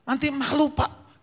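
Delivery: high, strained, slow complaint; comic voiceless pleading, pitched high; imitated lost voice. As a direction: squeeze out a protest with a failing voice. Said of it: "suara saya habis".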